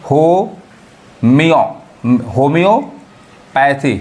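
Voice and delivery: very slow homeo in parts, then homeopathy split before "pathy"; drawn-out teaching breakdown of homeopathy; syllable by syllable. Shown as a demonstration. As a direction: break homeopathy up with pauses, lifting after "homiyo". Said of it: "ईओ sound पढ़ाता है".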